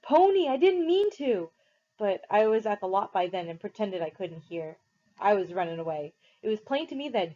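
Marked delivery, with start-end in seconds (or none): 0.0-1.5 very high, slow, loud anguished cry; desperate scream. A narrator's reading. Derry screaming after Pony running away, desperate. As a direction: call after him in panic. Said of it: "Derry screamed".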